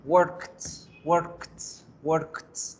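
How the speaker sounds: worked said as "workt" with a t at the end. Shown as a demonstration. Teacher models worked for students, ending on a crisp t. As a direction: end worked on a clear t sound. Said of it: "Tenemos que hacer como el sonido de una T al final".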